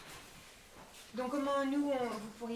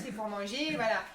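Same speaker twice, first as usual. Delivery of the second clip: ordinary talk, nothing marked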